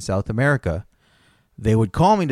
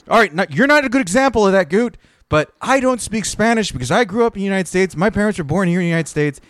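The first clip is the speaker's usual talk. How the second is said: high, louder exasperated retort; exasperated rant; 9 semitones higher, 5 dB louder than usual. Retold or imitated. Retelling his answer to Goot on the quarterdeck, high exasperated rant. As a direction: vent it up high and loud. Retold exasperated reply to shipmate.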